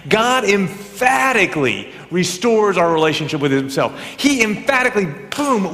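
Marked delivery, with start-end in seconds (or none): 0.1-1.9 "emphatically" hit firmly, with weight; emphatic. Shown as a demonstration. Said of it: "I want to say emphatically". 4.2-5.7 "emphatically" pitched high and punched, capped with "boom"; exclamation-point emphasis. Shown as a demonstration. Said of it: "with an exclamation point".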